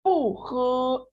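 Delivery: पू हे drawn out for learners; careful drill reading; slow tone demo. Shown as a demonstration. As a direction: model पू हे slowly. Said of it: "next".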